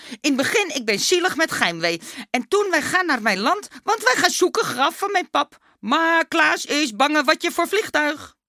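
put-on high, emphatic mummy boy in broken Dutch; Dummy, eager. A narrator's reading. Dummy recounting the trip to the teacher, high eager voice.

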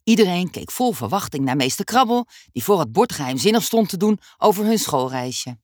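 ordinary delivery, nothing marked

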